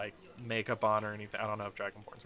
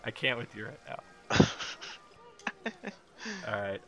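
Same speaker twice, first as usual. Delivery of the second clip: ordinary talk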